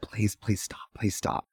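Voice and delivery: soft, urgent please stop; quiet plea. Retold or imitated. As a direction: hush her without breaking the show. Pleading quietly with a drunk heckler.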